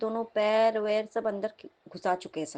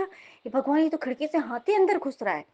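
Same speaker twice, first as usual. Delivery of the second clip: higher-pitched retold alarm; 5 semitones higher, 4 dB louder than usual. Dreamer crying to God as an elephant enters, alarmed. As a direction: exclaim in mild panic, pitch raised.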